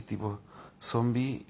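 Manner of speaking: ordinary talk, nothing marked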